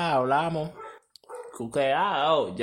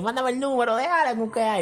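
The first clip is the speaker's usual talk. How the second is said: cousin voiced; 8 semitones higher than usual. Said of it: "la prima dijo".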